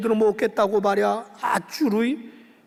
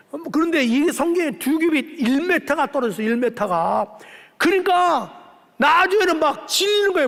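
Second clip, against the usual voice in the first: normal talking voice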